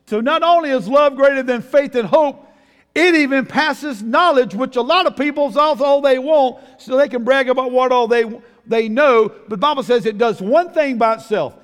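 loud, high, forceful sermon delivery; preaching. Real, not acted.

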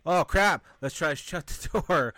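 high, loud sudden alarm; startled. Retold or imitated. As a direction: blurt it in a scare. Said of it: "two other guys walk by and like".

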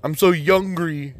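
"youngry" for hungry, with a Y. Retold or imitated. Mocking H-droppers by analogy, hungry twisted into "youngry". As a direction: put a Y where the H of hungry goes, "youngry". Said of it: "Like, nobody says".

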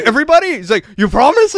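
high, loud imitated excitement; mock eager questioning. Acting out Venom's eager questions.

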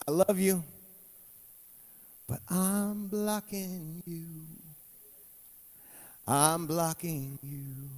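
soft and slow, I'm blocking you; quietly closing the door on them; soft firm goodbye. Retold or imitated. Blocking the angry friend.

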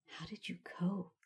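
breathless unvoiced whisper. A narrator's reading. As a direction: whisper it in awe. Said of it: "she whispered".

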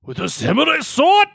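samurai sword in a put-on Korean accent. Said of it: "in a Korean accent".

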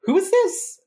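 mock-shocked high squeak; baffled who's this. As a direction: squeal it out in comic disbelief. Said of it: "both of them are literally just like".

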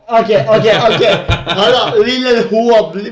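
loud, laughing protest. Real, not acted. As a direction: laugh it off loudly. (skrattande högljutt protesterande)

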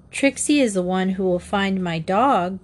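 plain reading voice

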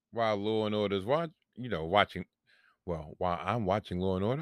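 usual speaking voice

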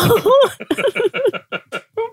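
a long high giggle; high laughter. Real, not acted. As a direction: laugh freely at him. Laughing at his full mouth.

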